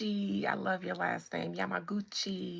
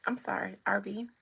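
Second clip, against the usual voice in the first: ordinary talk, nothing marked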